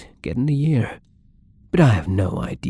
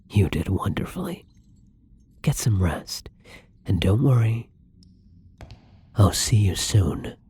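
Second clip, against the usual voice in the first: tender whisper. Acted. Whispering to the dozing prisoner, tender and soothing. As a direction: hush them gently to sleep.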